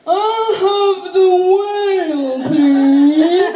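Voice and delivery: very slow, high, loud sung-out whale call. Retold or imitated. Comic imagining dad ordering like a Finding Nemo whale, very slow and loud. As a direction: stretch it like Nemo's whale. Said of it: "he didn't go".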